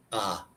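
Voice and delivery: Ah held as one open sound; open A vowel. Shown as a demonstration. Showing the Spanish A to a friend, an open Ah. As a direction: say Ah purely, without gliding to ay. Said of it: "depends where your tongue is".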